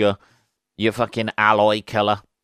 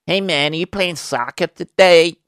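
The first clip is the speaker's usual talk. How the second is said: higher mock American accent, bright and eager; 6 semitones higher, 3 dB louder than usual. Brit mocking Americans talking soccer, American accent. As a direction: put on an American dude voice. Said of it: "the Americans say".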